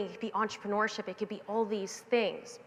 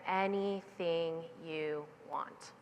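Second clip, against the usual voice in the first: softer, very slow, lingering; tender repeat; 4 semitones lower, 8 dB quieter, much slower than usual. Retold or imitated. Mother repeating her promise, soft and slow. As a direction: let it land slowly, full of love. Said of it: "She said".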